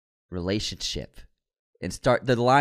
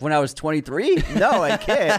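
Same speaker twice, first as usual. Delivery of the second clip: higher, louder mock protest; incredulous refusal; 7 semitones higher, 4 dB louder than usual. Actor refusing the producers' hair request, incredulous. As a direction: balk in raised-pitch disbelief.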